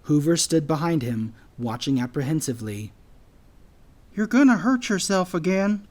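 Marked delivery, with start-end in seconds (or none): 4.1-5.9 high-pitched anteater voice, cautioning; worried warning. A narrator's reading. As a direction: warn a friend in a higher, anxious voice. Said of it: "Hoover warned".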